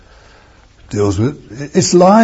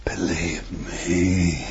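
hushed whisper; 7 semitones lower, 10 dB quieter, much slower than usual. Acted. Jesus speaking softly to woman.

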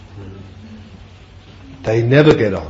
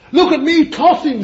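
very high, loud mock unicorn bragging; boastful unicorn; 7 dB louder than usual. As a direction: brag like a prancing unicorn. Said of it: "says the unicorn".

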